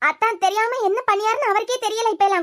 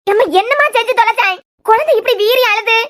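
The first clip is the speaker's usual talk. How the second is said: loud, exasperated questioning; furious scolding; angry demand; 3 semitones higher, 7 dB louder than usual. Acted. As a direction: demand an answer at the top of your voice. Mother demands why baby cries.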